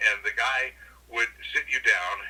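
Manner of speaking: normal talking voice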